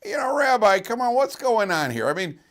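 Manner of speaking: high, loud, incredulous challenge; incredulous congregant. Retold or imitated. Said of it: "one of the guys in Shir said to me".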